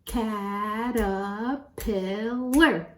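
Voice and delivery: "Caterpillar" very slowly in four drawn-out beats; syllable by syllable. Shown as a demonstration. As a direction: count out every syllable of "Caterpillar". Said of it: "count them".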